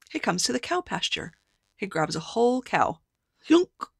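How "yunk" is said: a loud high yunk; gulping sound. A narrator's reading. Storyteller making the giant grab a cow. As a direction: make the giant's grab pop.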